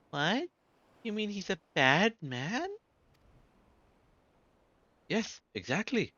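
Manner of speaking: put-on little girl, high and grateful; high child voice. Small girl thanking her rescuer, high child voice.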